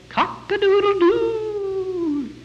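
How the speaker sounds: high, drawn-out rooster crow. Pretend. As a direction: crow like a cockerel, long and high. Rooster crows at Peter's denial.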